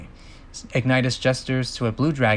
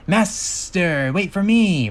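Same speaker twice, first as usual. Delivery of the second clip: higher, rising cry; desperate call after master; 7 semitones higher, 3 dB louder, much slower than usual. Acted. Pox to his master as the gem falls, desperate call.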